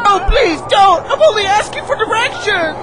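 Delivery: hammed-up, high-pitched protest; frantic pleading. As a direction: plead desperately over a panic. Pleading with panicking people.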